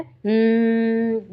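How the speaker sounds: long slow buzzing hum; bee hum. Shown as a demonstration. Teacher hums like a bee for the class. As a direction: drone it out slowly like a bee. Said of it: "बी क्या करता है".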